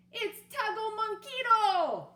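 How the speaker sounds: cheering. Acted. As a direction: cheer on your team. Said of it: "cheered the Chimichangos".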